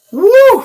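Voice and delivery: loud high whoop of victory; triumphant woo. Retold or imitated. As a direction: let out a big triumphant whoop after the lift. Lifter celebrating after the heavy lift.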